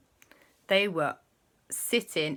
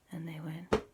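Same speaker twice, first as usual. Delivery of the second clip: usual speaking voice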